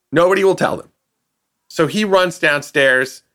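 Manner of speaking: normal talking voice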